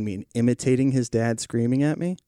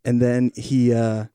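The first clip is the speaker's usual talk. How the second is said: usual speaking voice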